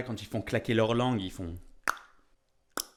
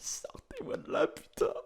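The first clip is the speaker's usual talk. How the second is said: tiny whisper; 5 dB quieter than usual. Pretend. Shrimp voice, whispered.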